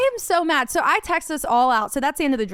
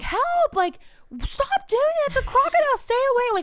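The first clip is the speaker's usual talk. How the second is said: high frantic pleading, far higher; high-pitched mock scream for help; panicked scream; 13 semitones higher than usual. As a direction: beg them to stay away from the crocodile, panicking. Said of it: "And so I'm screaming".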